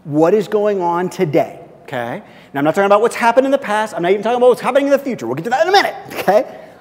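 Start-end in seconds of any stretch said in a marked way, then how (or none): 2.5-6.7 fast, higher and louder throwaway; rapid aside. Acted. Pastor brushing past a point for the church, rapid. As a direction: rush through it to get back on track.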